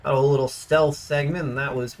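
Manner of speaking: ordinary delivery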